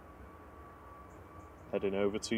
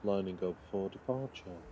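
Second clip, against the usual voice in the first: hushed wildlife documentary narration; soothing sleep voice; 2 semitones lower, faster than usual. Pretend. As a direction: lull them with a nature film read. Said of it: "This is my soothing sleep voice".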